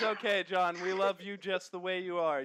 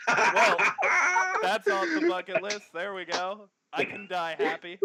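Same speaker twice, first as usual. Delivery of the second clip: laughing; 5 semitones higher, 9 dB louder than usual. Real, not acted. Host laughs with delight at request.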